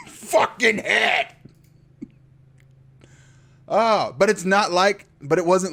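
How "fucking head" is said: slow outraged yell. Retold or imitated. Recapping the jump scare.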